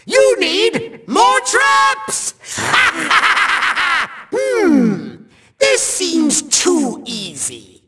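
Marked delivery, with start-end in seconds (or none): 2.4-4.1 triumphant villain laugh; evil cackle. Acted. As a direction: cackle in a rapid rolling burst. Villain gloats over traps.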